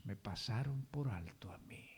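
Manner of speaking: hushed whisper of the king's last words; solemn whispered line. Acted. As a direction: let it drop to a breathy whisper. Shepherd's closing words, whispered.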